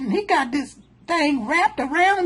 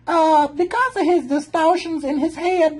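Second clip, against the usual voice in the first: usual speaking voice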